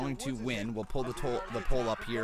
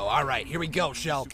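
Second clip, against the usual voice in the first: ordinary delivery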